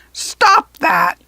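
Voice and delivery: high, loud goblin shriek; tied-up goblin shrieking at the fairies. A narrator's reading. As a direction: shriek it in protest. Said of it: "shrieked the front goblin".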